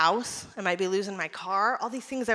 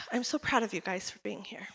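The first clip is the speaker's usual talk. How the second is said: sighed whisper; 3 semitones lower, 8 dB quieter than usual. Real, not acted. Proud moment with the audience.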